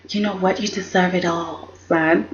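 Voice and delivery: soft, low imitation of a doting dad; hushed mock father. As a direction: murmur it like a proud father, half-mocking. Rich praising son, retold.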